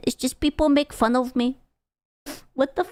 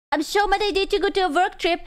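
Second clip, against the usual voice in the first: mock whiny quote; 7 semitones higher, faster than usual. Mock quoting chat message.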